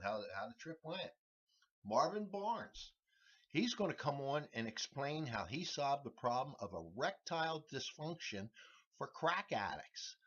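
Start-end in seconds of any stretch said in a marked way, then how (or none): none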